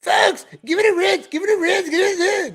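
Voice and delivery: high-pitched mock shouting of a pleader; hammed-up, squawked crowd appeal; mocking imitation. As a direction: mimic his pleading, high and loud. Mimics the man in the clip.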